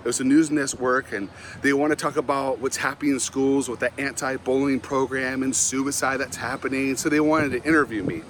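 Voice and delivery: normal talking voice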